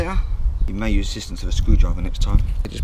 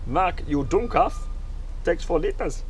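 mock German accent, slower and higher; 6 semitones higher, slower than usual. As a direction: tease yourself in a hammed-up German accent. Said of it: "Sorry for the German accent".

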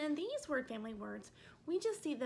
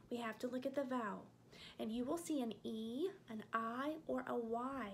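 ordinary delivery

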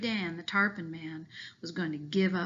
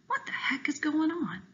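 whisper; 9 semitones higher than usual. Pretend. Shark wondering, imagined thought.

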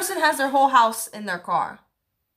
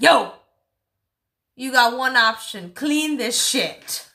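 mock call-out. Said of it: "I would be like".

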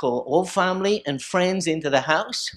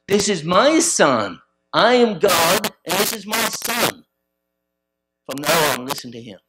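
voice of God; 4 semitones higher, 8 dB louder than usual. Retold or imitated. Preacher voicing God over the crowd, booming. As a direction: thunder it down from the sky. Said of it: "there was another voice came out".

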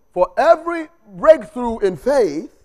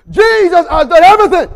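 shouted, much higher and louder; full preaching shout; 9 semitones higher, 11 dB louder than usual. Shown as a demonstration. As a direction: proclaim it at the top of your voice. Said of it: "One more time".

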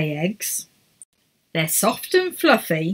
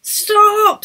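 high, loud shout of stop; commanding shout; 13 semitones higher, 6 dB louder than usual. A narrator's reading. Edith stopping Errol in the storm, loud shout. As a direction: bark it to halt everyone. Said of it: "said Edith".

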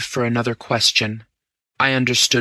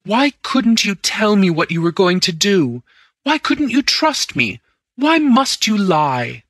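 higher, louder wounded reproach; hurt demanding; 7 semitones higher, 3 dB louder than usual. A narrator's reading. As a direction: press him, stung and indignant. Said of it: "I demanded".

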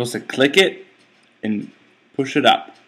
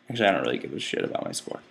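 muttered aside; 10 semitones lower, 10 dB quieter, much faster than usual. Real, not acted.

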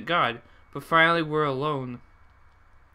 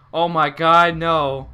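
startled fear; 9 dB louder, much slower than usual. Real, not acted. Player reacting to scary line.

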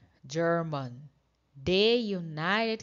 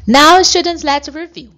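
calling the class; 9 semitones higher, 18 dB louder than usual. Real, not acted. Teacher calling class to attention.

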